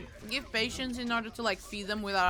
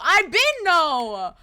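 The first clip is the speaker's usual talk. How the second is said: drawn-out high whine; 6 semitones higher, 9 dB louder, much slower than usual. Retold or imitated. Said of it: "I was just like".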